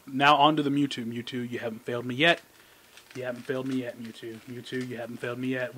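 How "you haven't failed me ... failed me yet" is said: ordinary delivery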